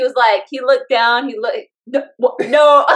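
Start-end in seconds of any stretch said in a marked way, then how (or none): 1.9-2.9 stammered, louder disbelief; broken-off, incredulous no; retold double take. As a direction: imitate a stunned husband. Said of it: "It was like a double take".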